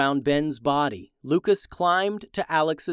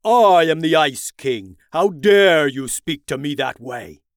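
roaring tyrant king; 9 dB louder than usual. Acted. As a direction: roar it like an outraged king. King outraged at insult.

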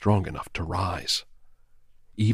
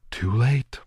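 eerie, higher ghostly taunt; cold ghost girl; 4 semitones higher, 3 dB louder than usual. A narrator's reading. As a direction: pronounce his doom coolly. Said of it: "she told him".

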